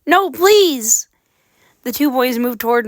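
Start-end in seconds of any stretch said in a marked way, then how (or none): 0.0-1.1 frightened plea. Acted. Begging the ghosts.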